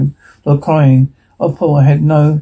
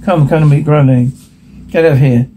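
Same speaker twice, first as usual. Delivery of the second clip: ordinary delivery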